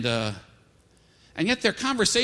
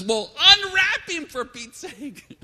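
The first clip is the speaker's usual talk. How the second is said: high, loud, drawn-out comic exasperation; mock-exasperated command, stretched out; exasperated shout; 11 semitones higher, 8 dB louder, much slower than usual. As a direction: yell it like you can't believe nobody has helped.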